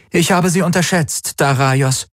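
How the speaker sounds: high-pitched aristocratic woman's voice; Ylana, cool commander. A narrator's reading. Ylana to Daraios before her guards, cool and high. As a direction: speak high and composed to an enemy.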